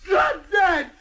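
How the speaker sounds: furious shout. Acted. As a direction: fling it at him full voice. Yelling back at the driver.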